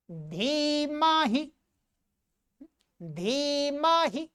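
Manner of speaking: slow धीमहि, long 'dhee', short final 'hi'; long-then-short I. Shown as a demonstration. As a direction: stretch the start of धीमहि and clip its end. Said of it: "ये छोटी ई है". (धीमहि, आख़िरी इ छोटी)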